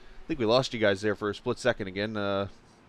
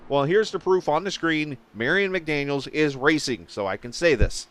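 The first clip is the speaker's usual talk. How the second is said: usual speaking voice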